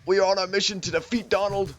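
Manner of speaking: high, hammed-up New Jersey politician; comic stump-speech impression; Bernie Sanders. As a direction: declare a campaign mission in mock earnest. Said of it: "too much New Jersey".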